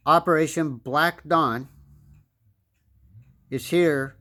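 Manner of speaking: ordinary delivery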